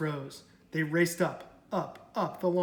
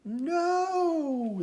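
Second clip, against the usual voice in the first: higher, louder crowd cry; yelled; 12 semitones higher, 4 dB louder than usual. Acted. The crowd refusing to be Eagle's lunch, yelled higher. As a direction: cry it out together. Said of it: "the crowd yelled".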